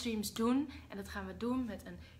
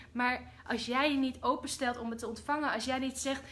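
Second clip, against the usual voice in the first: ordinary talk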